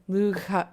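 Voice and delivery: "Lugha" with a throaty gh; fricative gh sound. Shown as a demonstration. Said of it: "G H makes a g sound".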